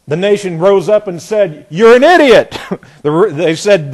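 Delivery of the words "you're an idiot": loud, high, outraged snap back; indignant retort. Pretend. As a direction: shout it back at the bully. The nation rose up and said.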